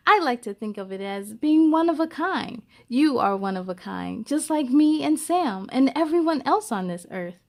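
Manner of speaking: gentle, expressive storybook reading; lively read-aloud for young listeners; reading to children. Acted. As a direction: read it like a picture book to a class. Reads a picture book aloud.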